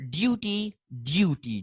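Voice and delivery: duty as "dyoo-tee"; y glide after the d in duty; British pronunciation. Shown as a demonstration. Teacher showing the British form, duty as "dyoo-tee". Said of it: "while they say duty".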